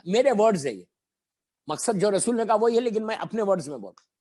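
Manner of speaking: usual speaking voice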